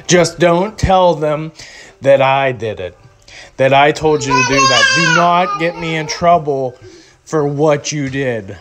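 ordinary talk

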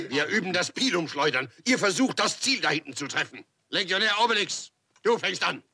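ordinary talk, nothing marked

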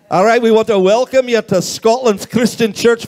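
put-on playful Scottish accent. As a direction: welcome them in a Scottish accent. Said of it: "to welcome you with a Scottish accent".

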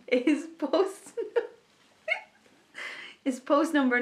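high, loud, laughing through the tickles; being tickled. Real, not acted. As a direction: push through giggles to read it. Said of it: "oh, he's tickling me".

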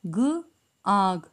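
आ and ग drawn out slowly, then joined as आग; teacher sounding out a word very slowly; slow blending. Shown as a demonstration. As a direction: sound out each letter, then glue them. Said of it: "जोड़ कर".

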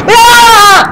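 high loud startled scream; frightened scream. Real, not acted. Player screams when the monster appears, high loud scream. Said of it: "Jag skrek då".

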